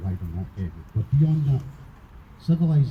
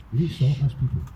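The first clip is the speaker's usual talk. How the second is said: raised, louder plea to stop, real anger; angry outburst; 5 semitones higher, 4 dB louder than usual. Real, not acted. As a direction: let the anger break through, higher and louder. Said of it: "Now, I'm getting very angry".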